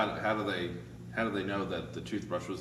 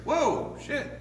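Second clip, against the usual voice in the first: acted alarm; 3 dB louder than usual. Salesman sees shotgun levelled.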